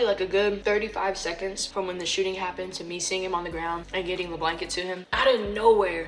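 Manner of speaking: ordinary talk, nothing marked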